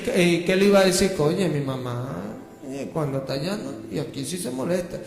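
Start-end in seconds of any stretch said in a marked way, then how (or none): 1.2-5.0 mock soft-spoken. Preacher mocking soft-spoken Christians, hushed fake-sweet voice. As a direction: tiptoe through it like a falsely sweet believer. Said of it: "usted anda suavecito".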